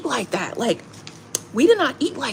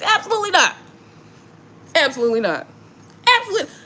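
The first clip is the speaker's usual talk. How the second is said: getting mad; 8 semitones higher, faster than usual. Real, not acted. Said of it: "I'm getting mad about this".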